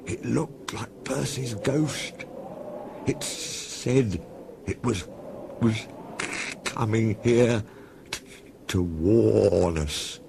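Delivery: slow, halting, scared telling; shaky voice. A narrator's reading. Toby tells Thomas of the ghost, slow and shaky. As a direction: stammer it out slowly, spooked. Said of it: "said Toby in a shaky voice".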